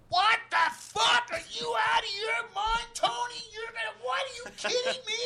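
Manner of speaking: big booming outraged shout; explosive incredulous bellow at a friend; pissed-off yell. Retold or imitated. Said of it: "'cause he yelled. He got pissed".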